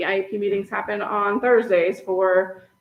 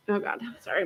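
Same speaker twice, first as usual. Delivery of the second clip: usual speaking voice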